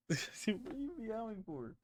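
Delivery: high, soft pet talk; cooing at cat. Real, not acted. Talking to her real cat.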